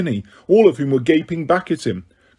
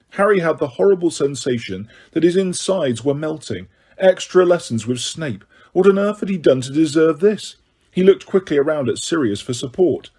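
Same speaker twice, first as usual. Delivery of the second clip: ordinary delivery, nothing marked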